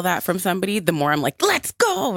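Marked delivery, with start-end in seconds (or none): 1.4-2.2 excited. Retold or imitated. Retold eager reaction.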